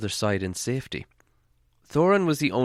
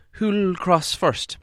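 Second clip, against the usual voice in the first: hobbit asking; 9 semitones higher, much slower than usual. Acted. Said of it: "asked Bilbo".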